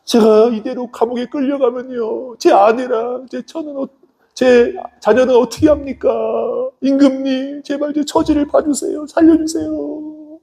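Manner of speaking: high, drawn-out wailing plea, acted; tearful pleading. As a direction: beg the king for mercy in a hammed-up whine. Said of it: "눈물 흘리면서". (울먹이며 높고 길게 늘여 애걸하듯)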